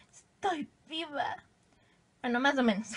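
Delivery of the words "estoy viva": estoy viva said slowly and softly, stretched; slow emphatic. Shown as a demonstration. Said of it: "por si acaso alguien no lo sabía". (lento y suave, estirando estoy viva)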